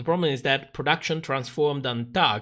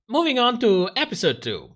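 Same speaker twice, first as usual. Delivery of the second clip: higher, loud announcer-style transition; bright announcing; 7 semitones higher, 4 dB louder than usual. Real, not acted. Host announcing the next episode to viewers, upbeat. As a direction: sing out the segue like a host. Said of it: "mock announcer transition".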